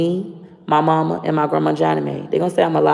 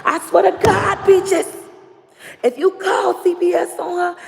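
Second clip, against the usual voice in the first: high-pitched scolding grandma voice, much higher; imitating grandma Johnny Mae; 12 semitones higher than usual. Granddaughter mimics grandma forbidding the call, high scolding voice. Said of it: "my grandma Johnny Mae said".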